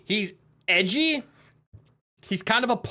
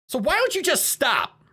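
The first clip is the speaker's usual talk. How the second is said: ordinary delivery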